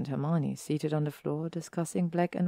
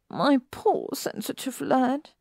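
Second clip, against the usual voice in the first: coddling Pansy; 9 semitones higher, slower than usual. Acted. Pansy coos over Neville.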